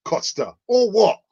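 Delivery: mock confrontational shout at Costa; angry defender. Defender confronting Costa on pitch, mock angry shout. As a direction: square up like a defender. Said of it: "I remember (defender voiced)".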